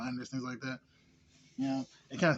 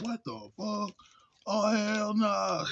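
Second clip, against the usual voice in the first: higher, slow, hammed-up disbelief; mock outraged; 9 semitones higher, 7 dB louder, much slower than usual. Retelling the furious cousin, slow mock-outraged high voice. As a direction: drag out the outrage like a cousin who has had enough. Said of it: "He was just pissed as fuck".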